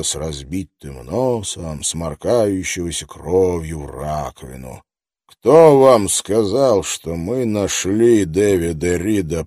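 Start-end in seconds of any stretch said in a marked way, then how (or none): 5.3-9.4 slow, hard words through gritted teeth. Acted. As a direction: grind out «Кто вам сказал» through clenched teeth. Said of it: "процедил он сквозь зубы".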